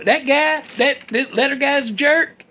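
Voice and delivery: ordinary delivery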